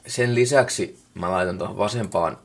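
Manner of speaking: usual speaking voice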